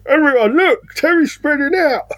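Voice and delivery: high, pointed tattletale call; tattling voice. Retold or imitated. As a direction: call it out to the whole table. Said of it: "old Table Talk Terry here".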